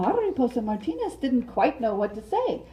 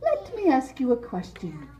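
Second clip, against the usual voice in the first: raised, sweet butterfly voice; Mariposa voice; 5 semitones higher than usual. Acted. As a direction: play the flirty butterfly asking her suitor. Mariposa voice, held role.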